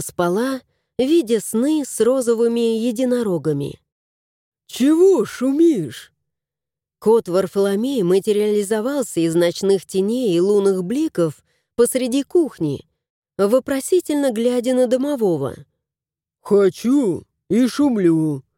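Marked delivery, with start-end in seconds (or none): 4.7-6.1 cat voice. A narrator's reading. 16.4-18.4 sulky house spirit. A narrator's reading. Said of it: "насупился тот".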